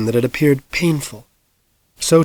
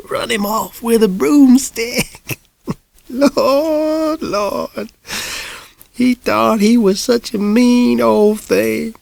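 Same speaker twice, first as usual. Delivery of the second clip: put-on much higher, slow, laughing old lady; amused old woman; 10 semitones higher, slower than usual. A narrator's reading. Old lady gleefully recalling the broomstick, laughing. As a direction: ancient lady cackling over the tale.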